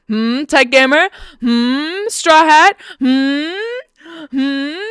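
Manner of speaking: high, rising, goading hums; taunting interrogation. Real, not acted. Streamer calls out viewers who flamed them, taunting. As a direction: needle them with pointed, drawn-out questions. Needles named viewers for answer.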